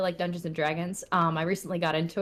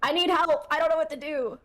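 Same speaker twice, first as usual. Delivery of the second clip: higher-pitched playful begging; mock plea for help; 9 semitones higher than usual. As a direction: plead in a high, hammed-up voice. Acted plea to Discord friends.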